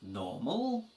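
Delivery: "normal" asked with the pitch lifted at the end; questioning rise. Shown as a demonstration. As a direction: turn "normal" into a question, voice rising on its last syllable. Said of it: "need to raise our pitch a little at the end".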